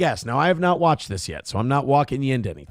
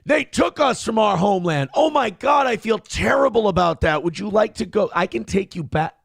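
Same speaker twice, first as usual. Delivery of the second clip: mock apologetic; 6 semitones higher than usual. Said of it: "mocking answer to the speech".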